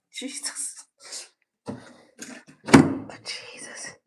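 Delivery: whispered under breath, winded; breathless whisper. Real, not acted. After forcing the jammed door, a breathless whisper. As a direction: gasp it out in a whisper, spent.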